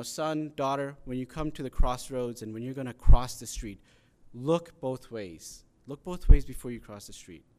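emphatic parent voice, slowing on the warning; lightly acted parent drilling in a safety rule, loud stresses; firm parental instruction. Parent teaching kids to cross the street, firm and emphatic.

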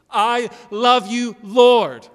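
slow, loud, high cry of praise; ringing, heartfelt proclamation; passionate declaration. Acted. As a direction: draw each word out as if bursting into song. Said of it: "Do you passionately sing?".

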